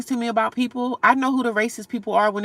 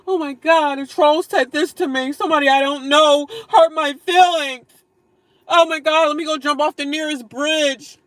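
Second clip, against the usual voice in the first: higher, louder, hammed-up hysterics; mock distraught victim; 6 semitones higher, 7 dB louder than usual. Mocking viewers who cry over trolls, hammed-up hysterical whine. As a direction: play a drama queen crushed by one troll comment. Mocks a troll-hurt woman.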